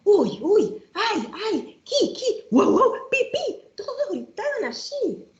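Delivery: high squeals, then slow barks and chirps; animal noises. Acted. Reading the rhyme, farm animals squealing high.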